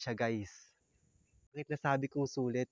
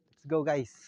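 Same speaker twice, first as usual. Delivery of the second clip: ordinary delivery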